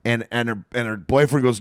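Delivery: usual speaking voice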